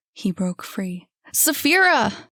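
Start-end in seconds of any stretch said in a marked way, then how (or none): 1.3-2.3 high, loud calling of her name. Acted. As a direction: call her name through the house. Boy breaks free, calls.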